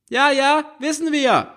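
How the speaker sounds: high, bored mum brushing it off; tired mother dismissing her daughter; high, weary mother's "yes, yes". A narrator's reading. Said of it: "kommentiert diesmal die Mutter".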